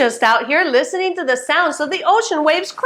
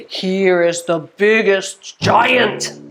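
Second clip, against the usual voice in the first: slow, low and heavy; big giant voice; 6 semitones lower than usual. Pretend. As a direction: drop low and stretch it to sound huge. Voices a giant's bigness in rhyme.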